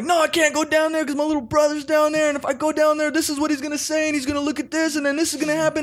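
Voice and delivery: put-on tripping teen ranting paranoid fears at his dad. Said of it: "I was going off".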